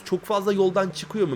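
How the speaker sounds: ordinary talk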